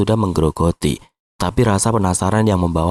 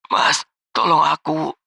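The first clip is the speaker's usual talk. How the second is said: high, slow female plea for help; woman's voice; 8 semitones higher, slower than usual. Acted. Unknown woman pleading to the guard by phone, high and drawn-out. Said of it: "suara wanita di seberang sana".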